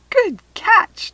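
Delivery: high, loud cheer; excited exclaim. A narrator's reading. Trixie praising Kirsty's catch, excited. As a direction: exclaim with delight. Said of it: "Trixie exclaimed".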